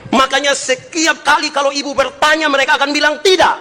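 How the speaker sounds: full-force preaching. Acted. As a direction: hammer out the warning to the wives.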